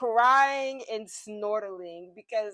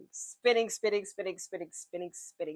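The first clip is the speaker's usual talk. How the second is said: fading away; 3 semitones lower, 5 dB quieter than usual. Pretend. Mind spinning, voice fades out.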